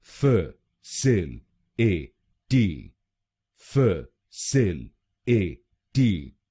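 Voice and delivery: very slow फ सिल ए टी, each part apart; syllable by syllable. Shown as a demonstration. Teacher breaking the word into slow syllables. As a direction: stretch facility out slowly. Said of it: "तो बनेगा facility".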